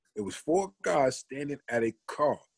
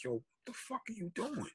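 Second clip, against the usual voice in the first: alarmed friends; 9 semitones higher, 14 dB quieter than usual. Retold or imitated. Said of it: "Mel and Ann looked at me like".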